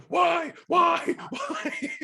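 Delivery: high, loud wailing why, breaking into laughter; possessed Josh. Retold or imitated. Friend imitating possessed Josh from the movie, wailing. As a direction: wail like the possessed boy. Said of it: "I sound like Josh at the".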